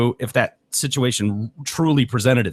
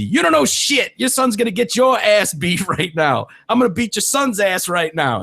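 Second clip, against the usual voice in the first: high taunting mock shout; hammed-up teen threat; mock yelling teen; 7 semitones higher than usual. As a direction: yell it like a cocky teenager. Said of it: "one of the teens is heard yelling".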